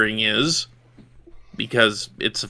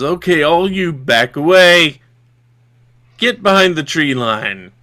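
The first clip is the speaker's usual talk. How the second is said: lightly gruff leader, louder, ordering people back; a touch of gruff authority, raised voice; gruff bandit; 3 semitones higher, 5 dB louder than usual. Acted. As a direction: call out a command as a gruff robber. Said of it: "you hear a very gruff voice".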